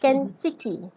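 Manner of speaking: "Kentucky" clipped down to "Kenchiki"; Japanese English short form. Shown as a demonstration. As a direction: say "Kentucky" as three crisp syllables, "ken-chi-ki". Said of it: "or in short".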